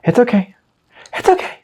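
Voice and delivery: high little reassuring voice; mock soothing. Player one square too far, mock soothing. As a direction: coo it high, in small separate bursts. Soothing self after mistake.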